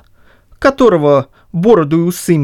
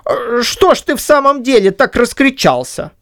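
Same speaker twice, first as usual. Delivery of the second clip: higher put-on voice, mock hurt; raised, feigned indignation; same disguised voice; 10 semitones higher than usual. A narrator's reading. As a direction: Chub still faking, higher. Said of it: "произнёс он тем же голосом".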